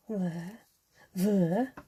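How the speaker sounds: drawn-out catchphrase imitation. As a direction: lightly mimic a singer's catchphrase.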